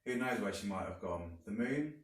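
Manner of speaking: ordinary delivery, nothing marked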